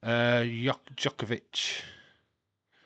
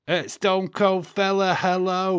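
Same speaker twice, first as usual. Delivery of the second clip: loud greeting; 8 semitones higher, 8 dB louder than usual. Real, not acted. Greeting chat viewer loudly.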